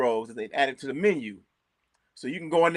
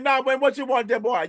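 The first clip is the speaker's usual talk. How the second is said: a light imitation of a Jamaican accent, a bit higher; 8 semitones higher than usual. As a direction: playfully mimic the Jamaican chef's accent. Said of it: "when he can talk to you with that accent".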